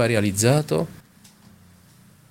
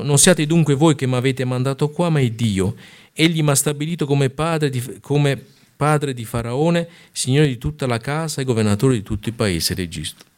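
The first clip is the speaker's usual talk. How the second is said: raised, declaiming Bible reading; scripture reading; 4 semitones higher than usual. Acted. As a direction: read the passage out with solemn weight. Reading verse eight aloud.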